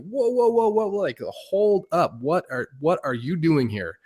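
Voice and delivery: high-pitched "whoa" easing down into a question; startled, high mock protest; retold alarm. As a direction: re-enact halting someone who barges in. Halting a truck storming into camp.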